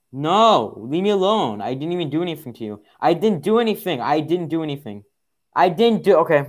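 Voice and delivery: high, loud indignant pleading; loud protest. Real, not acted. Player under attack begs to be left alone, loud protest. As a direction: protest your innocence at full volume.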